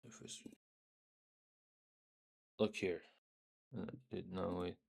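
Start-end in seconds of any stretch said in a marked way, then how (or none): none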